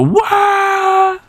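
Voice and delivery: high wailing death scream; cartoon falling yell; death cry. Shown as a demonstration. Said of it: "he'll do, like, the death scream".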